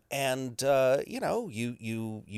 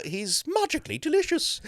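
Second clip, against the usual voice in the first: leprechaun voice. Shown as a demonstration. Lucky slogan in character.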